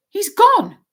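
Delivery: high, loud startled exclamation; Harry gasping that the wizard is gone; surprised cry. A narrator's reading. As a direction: blurt it out in shock. Harry shocked card face vanished.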